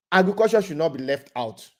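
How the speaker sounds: ordinary talk, nothing marked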